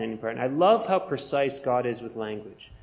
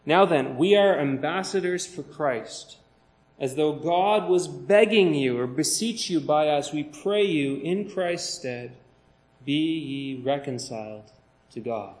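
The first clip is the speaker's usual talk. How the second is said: slow, deliberate scripture reading; slower than usual. Acted. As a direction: read the verse with weight. Reads scripture aloud in sermon.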